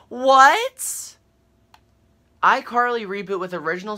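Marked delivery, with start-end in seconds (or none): 0.1-1.1 high, loud stunned squeal; shocked what. Real, not acted. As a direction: blurt it out in total disbelief. Shock at adult reboot.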